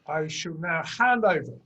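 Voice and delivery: ordinary talk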